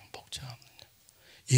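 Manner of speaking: slow hushed whisper. Acted. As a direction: breathe it out slowly. Repeats phrase as whisper. (천천히 속삭이듯)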